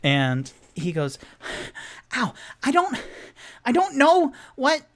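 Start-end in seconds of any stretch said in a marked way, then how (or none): 2.1-4.9 high, loud, frantic rambling voice; flustered and squeaky, stammering; Charlie Day impression. Tipsy protesting to the halfling woman, high and frantic. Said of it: "his best Charlie Day impression".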